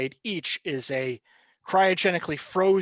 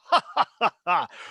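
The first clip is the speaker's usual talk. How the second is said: gloating mock laugh from the villain; 3 semitones higher, 4 dB louder than usual. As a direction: let out a cruel amused laugh. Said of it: "he lets out a laugh".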